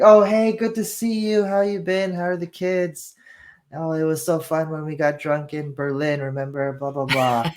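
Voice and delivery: hammed-up chummy sales agent. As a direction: gush at the buyer like an old drinking buddy. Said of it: "and John's like".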